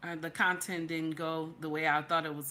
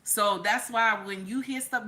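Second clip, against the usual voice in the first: ordinary talk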